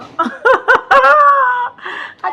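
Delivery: real laugh, high and loud; cracking up at her own joke; high burst of laughter. Real, not acted.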